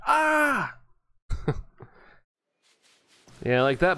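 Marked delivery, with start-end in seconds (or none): 0.1-0.7 high shrieking squeal; girly scream. Retold or imitated. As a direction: shriek like a startled little girl. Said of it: "I scream like a little girl".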